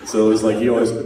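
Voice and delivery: ordinary delivery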